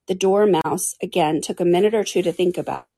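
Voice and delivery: usual speaking voice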